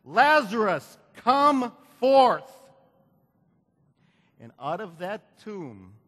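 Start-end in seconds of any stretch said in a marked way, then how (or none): 0.0-2.5 loud command. Acted. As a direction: call the name out loud and commanding. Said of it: "with a loud voice".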